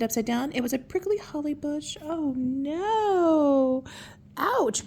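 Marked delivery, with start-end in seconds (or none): 2.0-4.8 high pained yelp; cried out. Acted. Twinkle landing in holly, crying out in pain. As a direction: squeal as the prickles bite. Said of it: "cried Twinkle".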